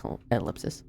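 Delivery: soft, breathy whisper; whispered ellipsis. Acted. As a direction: breathe it out, barely voiced. Reading an ellipsis as dialogue.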